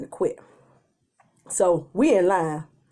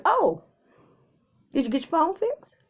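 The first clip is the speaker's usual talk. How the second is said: angry but calm; 7 semitones higher than usual. Retold or imitated. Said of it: "really angry and really calm".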